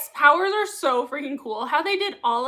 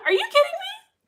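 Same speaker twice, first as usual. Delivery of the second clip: high-pitched squeal of disbelief; delighted disbelief; 4 dB louder than usual. Real, not acted. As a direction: react as if the news is too good. Amazed by news of the recipe.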